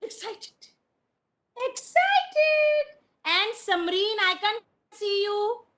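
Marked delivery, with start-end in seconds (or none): 0.0-0.7 breathy whisper of the word. Shown as a demonstration. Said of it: "how do you make excited face?". 1.7-2.8 much higher, bright excited call. Shown as a demonstration. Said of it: "how do you make excited face?".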